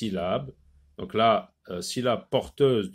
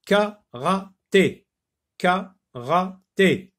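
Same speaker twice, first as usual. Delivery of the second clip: "Karaté" slowly in three separate, higher-pitched syllables; 5 semitones higher, much slower than usual. Shown as a demonstration. Teacher showing pupils the third syllable, "karaté" syllable by syllable. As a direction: sound out "karaté" with a pause after every syllable. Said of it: "Dans la troisième syllabe".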